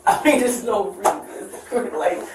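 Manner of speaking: nervous laugh. Real, not acted. Nervous laugh during arrest.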